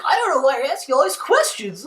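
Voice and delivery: mock him in a high whine; high mocking whine of a guilty man; mocking whiny voice. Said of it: "And then John's like".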